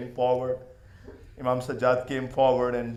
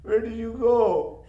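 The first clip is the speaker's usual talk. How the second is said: put-on child complaining; 7 semitones higher than usual. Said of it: "she started complaining".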